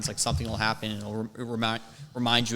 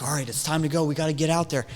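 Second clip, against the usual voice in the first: mock coworker calling out, raised pitch and volume; urgent hustling; 4 semitones higher, 4 dB louder, much faster than usual. Coworkers sending busser out onto the floor, urgent hustling. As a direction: hustle them along, louder and higher. Coworkers urging him out, retold.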